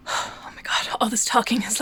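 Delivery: breathless, airy whisper; gasping between words; out of breath. Real, not acted. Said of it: "I'm really out of breath".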